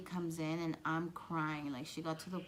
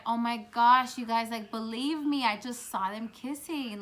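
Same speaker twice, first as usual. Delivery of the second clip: loud, higher, urgent gossip; excited retelling; 5 semitones higher, 10 dB louder than usual. Retold or imitated. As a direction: insist breathlessly that friends believe you. Retelling urgently telling friends the news.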